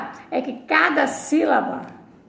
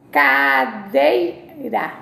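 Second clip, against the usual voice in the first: cadeira drawn out very slowly; slow pronunciation demo; much slower than usual. Shown as a demonstration. Teacher to class, saying cadeira as she says it. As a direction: stretch cadeira out syllable by syllable. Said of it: "como eu falo".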